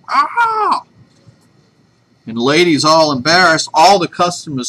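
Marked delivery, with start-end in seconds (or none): 0.1-0.8 high-pitched mock screech; bird's scream. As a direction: imitate a screaming bird, much higher than your voice. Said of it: "lets out a big scream".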